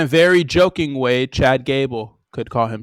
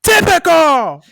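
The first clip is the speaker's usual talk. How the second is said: high, outraged sitcom voices; acted sitcom bit; 13 semitones higher than usual. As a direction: play the indignant sitcom scene, much higher. Acted New Girl scene exchange.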